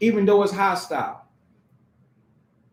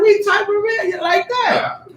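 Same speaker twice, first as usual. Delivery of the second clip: much higher, laughing mock buddy voice; imitating eager buddy; 9 semitones higher than usual. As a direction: play the guy going along with it, high and chuckling. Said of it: "You gonna be like".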